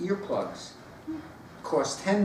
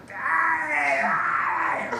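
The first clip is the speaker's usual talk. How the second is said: put-on child yelling; 10 semitones higher than usual. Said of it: "the kid's going".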